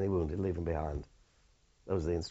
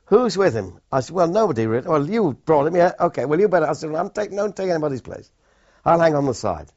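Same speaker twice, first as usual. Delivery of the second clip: shouted over noise; 8 semitones higher, 9 dB louder than usual. Retold or imitated. Shouting across river under fire.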